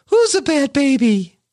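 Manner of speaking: a hint of teasing baby talk. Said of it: "the baby voice".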